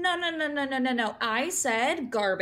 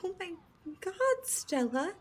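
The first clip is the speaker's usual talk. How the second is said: amazed; 8 semitones higher than usual. Acted. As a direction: gasp it out, thrilled and amazed. Amazed at Stella's language.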